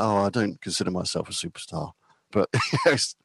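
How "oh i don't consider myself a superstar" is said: quietly mock-humble; talking quietly. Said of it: "talking really quietly".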